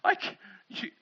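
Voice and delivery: stammering, breaking off in astonishment; speechless amazement. Acted. Awestruck follower faces Jesus after his feat, speechless amazement. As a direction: stumble over words, dumbfounded. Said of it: "you should just be speechless".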